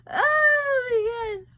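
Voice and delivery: slow, high, crying out; emotional cry. Real, not acted. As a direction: wail it overcome. Crying-shout recalling scene.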